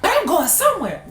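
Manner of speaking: put-on high child's answer; high stubborn kid voice; defiant child. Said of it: "look at me".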